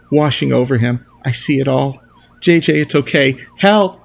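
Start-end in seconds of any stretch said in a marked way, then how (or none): 2.4-4.0 screaming for help. Acted. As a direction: call out in panic. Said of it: "I scream".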